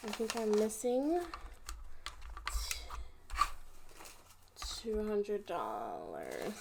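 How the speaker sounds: counting cash alone, worrying over missing money; worried mutter. Real, not acted. Counting envelopes, suddenly worried money is missing, quiet.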